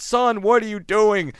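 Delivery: a dad caught off guard by his son; embarrassed father scolding; ashamed dad. Retold or imitated. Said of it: "his dad's all ashamed".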